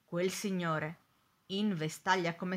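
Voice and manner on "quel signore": ordinary delivery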